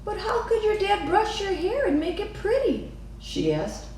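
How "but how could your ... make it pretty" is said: put-on much higher child voice, wondering; a young girl puzzling it out; curious little girl. A narrator's reading. Lily asks Mama.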